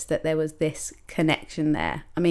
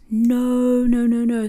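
high, louder mock dismay; pleading no, pitched up; inner panic; 5 semitones higher, 4 dB louder, slower than usual. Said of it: "So I'm like".

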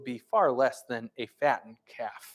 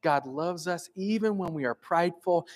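ordinary talk, nothing marked

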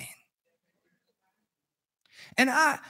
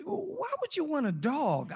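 questioning wife; 10 semitones higher, 4 dB quieter than usual. Retold or imitated. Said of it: "and she said".

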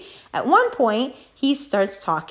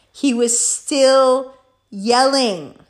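slow, disbelieving emphasis; emphatic exasperation; 2 semitones higher, 3 dB louder, much slower than usual. Real, not acted. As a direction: STILL and YELLING drawn out slowly, hit hard. Repeats for indignant emphasis.

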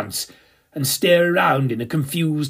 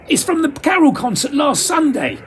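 much higher called-out answer; yelled reply; 12 semitones higher than usual. Acted. Stage manager yelling back at the narrator, high. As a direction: shout back over the racket. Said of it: "he yelled at me".

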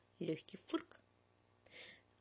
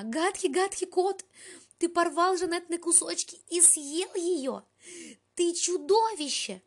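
high, loud anguished howl; wailing; 10 semitones higher, 8 dB louder than usual. A narrator's reading. Melanie howls at the cat over the torn doll clothes. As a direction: wail the name in despair.

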